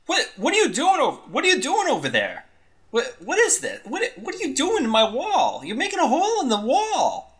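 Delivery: high, fast, panicked shouting; alarmed bartender. Acted. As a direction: squeal in alarm at the hole in the wall. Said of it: "you see the bartender saying".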